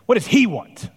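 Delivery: loud, high angry question; angry demand. A narrator's reading. Father asking his daughter about the visitor, loud and angry. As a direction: bark it out, furious at the visitor. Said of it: "flushed with sudden anger".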